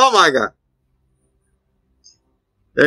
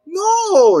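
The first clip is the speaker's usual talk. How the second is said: high, slow whimpering clown voice; whiny hurt clown. Retold or imitated. Mocking Pennywise whimpering at the insults, high and whiny.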